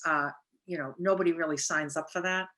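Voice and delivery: ordinary delivery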